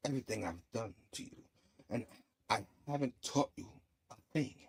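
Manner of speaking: low, soft and measured villain line; low-key villain. Retold or imitated. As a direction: say it quietly, unhurried, with pauses. Villain's measured reply to hero.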